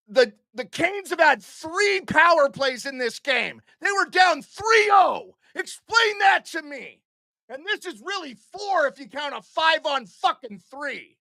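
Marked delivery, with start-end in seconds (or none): 3.8-7.0 high, raised demand; indignant shout. Real, not acted. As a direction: throw the demand at the refs in outrage. Streamer demanding an explanation.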